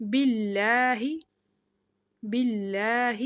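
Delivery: باللہ with a light lam held on the shadda; thin lam with shadda. Shown as a demonstration. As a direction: pause on the doubled lam of باللہ. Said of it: "تھوڑی دیر رہ کے، ٹھہر کے پڑھنا ہے". (باللہ میں باریک لام، شد پر ٹھہر کر)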